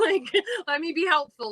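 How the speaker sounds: high, real laugh through the words. Real, not acted. Theater lover laughs about begging to help, laughing. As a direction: laugh at her own eagerness.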